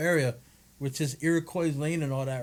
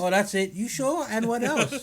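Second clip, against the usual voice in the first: pushy waitress; 7 semitones higher than usual. Retold or imitated. Acting out waitress upsell.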